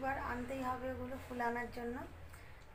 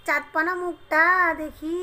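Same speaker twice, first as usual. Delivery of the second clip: stretched out slowly, loud and higher; slow fond coaxing; 3 semitones higher, 13 dB louder, slower than usual. Real, not acted. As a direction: drag out চাঁদপানা মুখটা দেখি lovingly. Coaxing daughter to show face. (ধীরে টেনে আদুরে ডাক)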